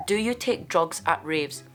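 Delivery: ordinary talk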